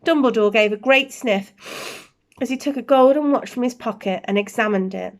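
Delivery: plain reading voice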